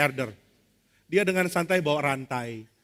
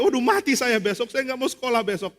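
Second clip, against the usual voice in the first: mock panic in a raised, high voice; panicked; 7 semitones higher, 3 dB louder than usual. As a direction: wail in dread of tomorrow. Friend panicking about school tomorrow. (mengeluh panik, nada tinggi)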